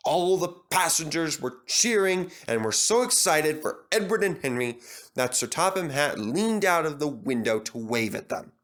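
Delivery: plain reading voice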